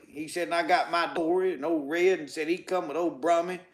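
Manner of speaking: ordinary delivery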